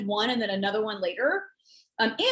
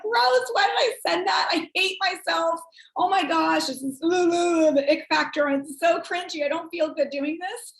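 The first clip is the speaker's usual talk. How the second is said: high, hammed-up cringing; disgusted; 5 semitones higher than usual. Sender regretting a cringy text, acting disgusted. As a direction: recoil in mock self-disgust, pitched up. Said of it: "'cause you feel disgusting".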